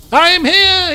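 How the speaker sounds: high, loud heroic announcement; Volpus's grand entrance. Acted. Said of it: "he says".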